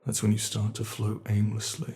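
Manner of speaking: ordinary talk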